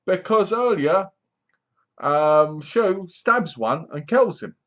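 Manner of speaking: ordinary talk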